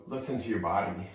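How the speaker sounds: normal talking voice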